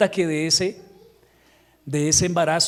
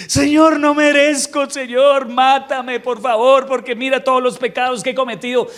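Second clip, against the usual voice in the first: much higher, louder begging wail; mock self-pity; 7 semitones higher, 5 dB louder than usual. Preacher mocking a David who groans every morning, loud begging wail. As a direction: beg to be punished, over the top. Imagined endless self-punishing prayer.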